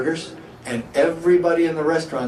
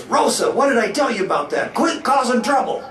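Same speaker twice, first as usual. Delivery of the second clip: scolding husband; 8 semitones higher than usual. Retold or imitated. Mister Parks scolds Rosa when she comes home, exasperated.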